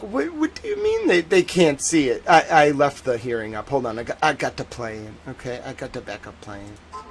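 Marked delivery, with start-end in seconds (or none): 0.0-2.2 a high, baffled squeak; mock surprise. Host mocks his own confusion at the screen, high surprise. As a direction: sound baffled at the missing screen. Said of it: "I was sitting there the whole time like".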